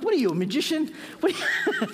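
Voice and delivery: playful amazed laugh at a pen trick; laughing mock disbelief. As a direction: laugh out the joke that he's a magician. Joking the professor is a magician.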